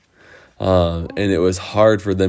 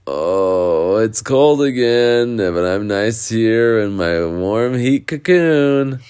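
slow, slightly raised character voice; cosy waking Wendy; 3 semitones higher, slower than usual. Acted. Wendy waking up cold in her cocoon, slow drowsy grumble. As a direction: stretch it out lazily, snug in bed.

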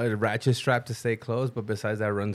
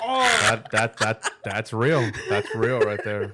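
laughing; 3 semitones lower, much slower than usual. Real, not acted. Cracking up at a joke.

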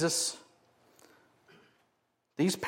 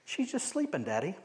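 imitating small child; 3 semitones higher, 6 dB quieter than usual. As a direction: play a small child reassuring Daddy. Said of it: "something a three-year-old would say".

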